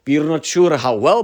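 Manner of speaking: usual speaking voice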